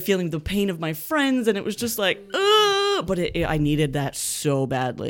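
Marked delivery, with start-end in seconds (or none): 2.3-3.1 high anguished ugh; anguished wail. Retold or imitated. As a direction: let out a pained wail. Crying release retold.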